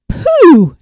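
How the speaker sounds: a loud, high, scornful snort of a word; snorted pooh. Acted. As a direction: blow the word out in contempt. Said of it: "the bear snorted".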